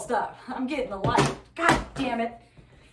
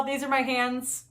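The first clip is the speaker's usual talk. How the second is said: ordinary talk, nothing marked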